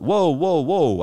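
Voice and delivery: mock alarm, trying to calm things down; alarmed backing off. To the man with the gun, retold alarmed placating.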